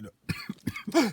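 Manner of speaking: hoarse, raspy from talking; rough, worn-out voice; smoker's rasp. Real, not acted. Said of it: "Gosh, I sound like I've been smoking".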